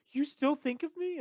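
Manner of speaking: high, loud mock-surprised question; imitated texter. Friend acting out a man's message, high mock surprise. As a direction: pitch it way up, mimicking the sender. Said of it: "he DMs you just like".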